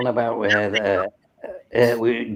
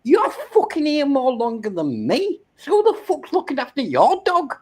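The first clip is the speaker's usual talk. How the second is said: incredulous retort; 9 semitones higher than usual. Retold or imitated. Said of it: "and I'm thinking".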